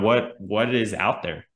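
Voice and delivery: ordinary talk, nothing marked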